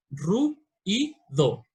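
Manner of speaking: "ru y do" en tres golpes, muy despacio; diéresis separada. Shown as a demonstration. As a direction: rompe "ruido" en tres sílabas lentas. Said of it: "romper el dictongo". ("ruido" en tres sílabas, despacio)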